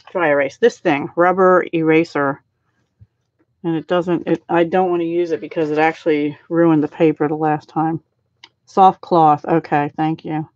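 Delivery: ordinary talk, nothing marked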